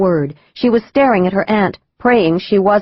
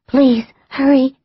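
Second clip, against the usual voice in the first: sickly whisper; 7 semitones higher than usual. A narrator's reading. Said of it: "she whispered".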